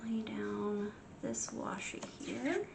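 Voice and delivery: soft slow whisper to herself. Real, not acted. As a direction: murmur it under your breath. Quiet aside while crafting.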